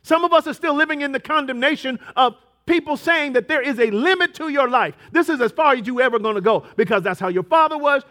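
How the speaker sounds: high, loud, insistent sermon; full-force preaching. Acted. Pastor warns the church about limits, loud and rising. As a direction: pound each line at the listeners.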